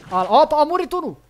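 high, loud disbelief; startled high-pitched exclamation; shocked outcry. Real, not acted. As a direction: cry out at a sudden kill. Sees an ally killed in game.

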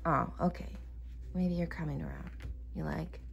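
soft, low coaxing murmur; hushed pet talk. Real, not acted. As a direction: murmur softly to the cat. Softly talking to the cat.

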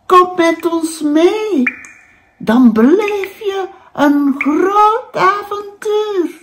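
high, bright little raindrop voice calling out; a cheerful raindrop calls to the boy, high and lively. Acted. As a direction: sing out like a tiny drop of water. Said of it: "riep het regendruppeltje".

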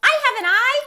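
high, loud excited shout; eager yell. Acted. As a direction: blurt it out proudly. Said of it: "Morris yelled".

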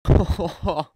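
loud burst of laughter from the cold shock; startled laugh. Real, not acted. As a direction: laugh out as icy water hits. Ice water dumped on him.

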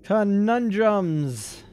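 very slow, high theatrical cry; dramatic exclaim. Pretend. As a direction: stretch it out dramatically. Stumped by puzzle.

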